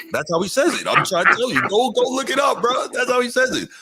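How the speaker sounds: 1 exasperated insisting. Real, not acted. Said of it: "insistent rant, pitch climbing".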